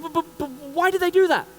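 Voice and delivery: high, loud stuttering panic; stammering shock. Retold or imitated. Stunned first-timer asks his instructors, high panicked stammer. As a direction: babble in disbelief. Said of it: "I'm like".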